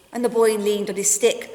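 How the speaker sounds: plain reading voice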